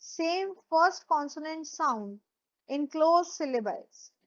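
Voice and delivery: very slow, word by word; dictated definition. Shown as a demonstration. Teacher to class, dictating the definition slowly. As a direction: dictate it so they can write. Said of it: "Alliteration क्या होता है".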